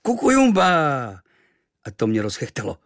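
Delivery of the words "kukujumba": high, loud, drawn-out falling "Kukujumba"; sudden screech. A narrator's reading. Native suddenly cries out to his new friend, screeching. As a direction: shriek "Kukujumba" out of nowhere. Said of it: "z ničeho nic zaječel".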